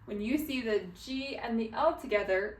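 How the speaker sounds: normal talking voice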